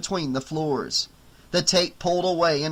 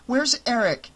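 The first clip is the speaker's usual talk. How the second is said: anxious; 8 semitones higher than usual. A narrator's reading. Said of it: "sounding anxious".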